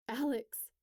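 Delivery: a mild alarmed cry. Acted. Steph seeing her friend fall, mild alarmed cry. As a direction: call her name in a light fright. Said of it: "cries Steph".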